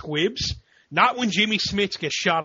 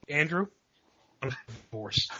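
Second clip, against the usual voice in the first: mock deadpan announcement; 2 semitones lower, 7 dB quieter than usual. Imagining the caller after hanging up, subdued deadpan. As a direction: drop down quietly for the comic bad news. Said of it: "it's just gonna be like".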